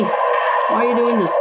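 pleading demand. Acted. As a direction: beg her to stop, drawing it out. Confronting Melanie in alarm.

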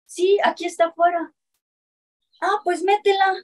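high mock voices of officers relaying orders; mimicked officials. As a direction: squeak out the officers' exchange like a parody. Officers answering, retold.

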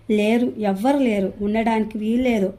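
usual speaking voice

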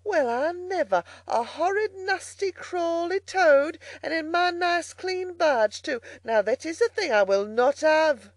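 high-pitched scandalised barge woman; disgusted outcry. A narrator's reading. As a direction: recoil in loud, indignant horror, pitch right up. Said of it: "she cried".

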